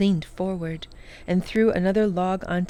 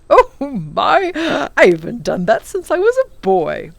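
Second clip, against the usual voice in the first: wickedly; 4 semitones higher, 9 dB louder than usual. A narrator's reading. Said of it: "said the professor wickedly".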